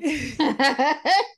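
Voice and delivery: loud, high cackling laugh; high burst of laughter. Real, not acted. Co-host laughing at a quip about being impressed, high laugh.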